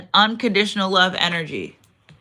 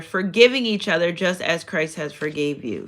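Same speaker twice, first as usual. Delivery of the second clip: ordinary delivery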